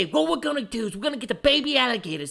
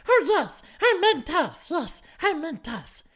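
high, wheedling creature voice; Smeagol; 7 semitones higher than usual. Acted. Hastily including his other self, high wheedling creature voice. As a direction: correct yourself in a high, eager whine. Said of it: "Smeagol wants it".

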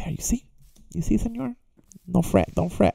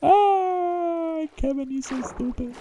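mocking voice; slower than usual. Voicing a viewer who mocks him, higher taunt. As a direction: tease in a sing-song mocking tone.

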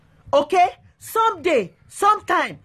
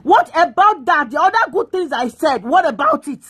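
usual speaking voice

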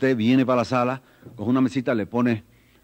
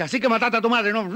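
angry policeman; 6 semitones higher than usual. Acted. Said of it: "le cae a puñetazo y dice".

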